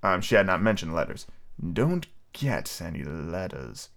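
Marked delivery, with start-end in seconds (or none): 1.7-3.9 low, slow, soft scorn; contemptuous. Acted. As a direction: sneer it slowly and quietly. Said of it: "he said contemptuously".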